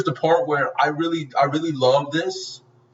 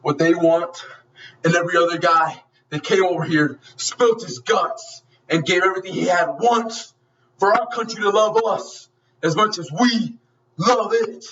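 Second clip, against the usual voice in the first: impassioned speech, pitch climbing toward the end; acted-out hero's heartfelt outburst; Rambo; 3 semitones higher than usual. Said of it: "And then Rambo goes".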